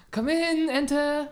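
high sing-out call; calling someone in. Acted. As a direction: summon the visitor with a high-pitched call. Calls the next suspect in.

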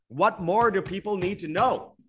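near-yell of frustration; frustrated outburst. Real, not acted. Host venting at viewers, frustrated near-yell. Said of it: "I'm not yelling at anyone out there".

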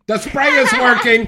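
loud, high, through laughter; laughing. Real, not acted. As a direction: burst out laughing while saying it. Repeats line laughing.

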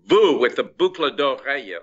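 loud, high mock-flirty Vous; teasing French vous. A waiter kidding French guests, loud and playful. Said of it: "they got the tone of voice because I was just".

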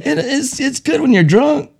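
giggling through the words, high-pitched; laughing high. Real, not acted. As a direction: squeal it out laughing.

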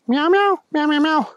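high, running string of meows; meowing kitty. Acted. Thunder Kitty answering the police chief, meowing. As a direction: chatter away in cat meows. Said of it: "said Thunder Kitty".

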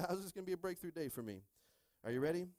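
ordinary talk, nothing marked

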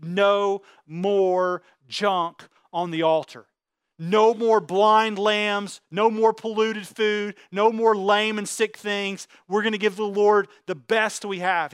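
very slow, each phrase spaced out and weighed; slow declaration. Acted. Faithful man to God at the altar, slow deliberate vow. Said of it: "stand up and say".

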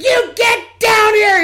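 very high, slow mock mom shout; an exasperated mom calling her son back; mother yelling.

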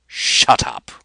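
very loud furious roar. Acted. Commander Rapaju roaring at Detis to be silent. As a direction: bellow it to shut him up. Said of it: "roared Rapaju".

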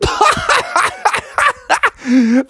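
high cackling hyena laugh. Acted. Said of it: "started laughing like a hyena".

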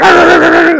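high, loud rap-style barks. Retold or imitated. Guy with dreads barks at the Canadian, loud high DMX barks. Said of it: "barking at me like a D M X song".

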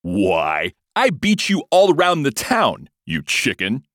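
loud, high, drawn-out taunt; angry. A narrator's reading. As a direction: flare up angrily at a rival. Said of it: "the lion replied angrily".

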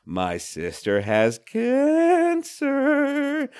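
slow, high, lilting sing-song; sing-songy. Shown as a demonstration. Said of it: "Nobody sing-songs stuff".

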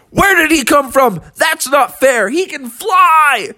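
high, hammed-up complaint, last word stretched; indignant protest. Mountain lion protests the interception.

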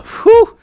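high, loud whew at the heat; hot-day whew, loud and high. Real, not acted. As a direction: blow out a big whew. Reacting to the heat.

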